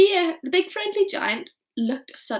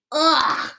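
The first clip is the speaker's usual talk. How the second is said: loud spluttered ugh; disgusted spluttering ugh; 5 semitones higher, 8 dB louder than usual. A narrator's reading. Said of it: "she spluttered".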